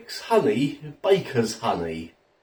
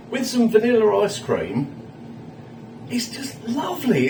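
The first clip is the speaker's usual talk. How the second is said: ordinary delivery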